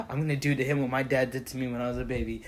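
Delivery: ordinary delivery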